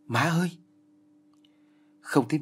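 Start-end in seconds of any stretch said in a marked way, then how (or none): 0.1-0.5 high-pitched startled call for mother; alarmed cry, narrated; panicked call. A narrator's reading. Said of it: "đã phát hoảng".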